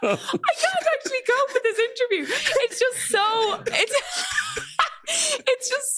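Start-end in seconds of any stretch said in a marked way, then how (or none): 0.0-3.6 real crying laugh, pitched way up; overcome, half sobbing half laughing; laughing through tears. Real, not acted. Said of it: "I don't know why I'm so emotional". 4.0-5.4 real squealing laugh-cry, much higher. Real, not acted. Host overcome with emotion.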